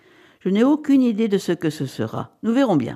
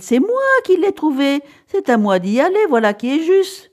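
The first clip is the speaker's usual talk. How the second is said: high, loud insistence; indignant protest; 7 semitones higher, 5 dB louder than usual. A narrator's reading. Wife claiming her right to the ticket, indignant. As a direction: argue your claim with rising agitation.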